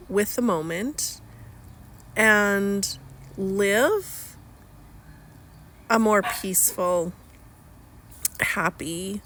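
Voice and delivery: slow, with long pauses between phrases; picture each item in your mind before saying it; speaking slowly. Real, not acted. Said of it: "I'm speaking slowly".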